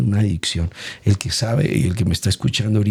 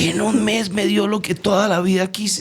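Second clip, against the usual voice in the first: much higher, voice cracking with emotion; tearful voice; 10 semitones higher than usual. Real, not acted. Telling how God granted his lifelong dream, voice much higher with tears. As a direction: still fighting back tears. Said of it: "Me acuerdo y me pongo a llorar".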